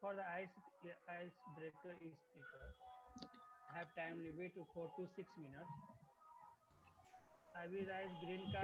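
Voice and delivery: very faint, far from the mic; barely audible. Real, not acted. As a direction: voice dropping away to almost nothing. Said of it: "Your voice is, uh, very low".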